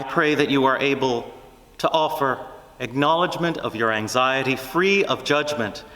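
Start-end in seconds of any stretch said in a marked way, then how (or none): none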